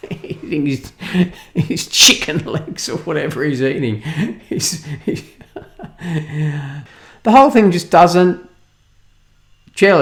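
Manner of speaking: laughing through the words; words breaking into real laughter, slowing down. Real, not acted. Critic cracking up describing the king eating, laughing.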